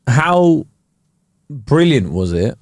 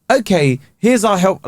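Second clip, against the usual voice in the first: higher-pitched mock chatbot; rudimentary chatbot; 7 semitones higher than usual. Chatbot offers help to customer, mock chatbot. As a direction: chirp it like an automated helper. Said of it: "really rudimentary A I that's like".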